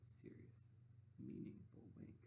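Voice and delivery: hushed whisper. A narrator's reading. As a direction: whisper it knowingly. Hushed narration.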